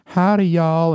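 high, genteel Southern greeting; upper-class Southern accent. Acted. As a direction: play a well-educated Southerner's accent. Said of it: "upper class Southern people".

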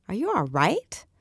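a hint of worried men asking together; mild concerned chorus of dinner guests. A narrator's reading. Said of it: "The men chorus it above me".

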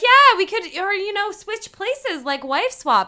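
very high, loud imitated enthusiasm; mock eager suggestion. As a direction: pitch it up, overeagerly proposing a swap. Acting out Roland's eager suggestion.

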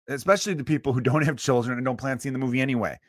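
usual speaking voice

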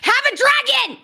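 high, excited cry of the answer; emphatic exclamation. Real, not acted. Answering co-host's why about the dragon, high emphatic exclamation. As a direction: burst out with it, pitch leaping up.

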